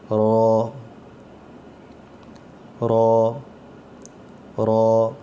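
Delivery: رو with a thick, full sound from the throat; not a thin را, make رو heavy. Shown as a demonstration. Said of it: "رو، اس کی آواز موٹی آئے گی". (رو موٹی آواز میں)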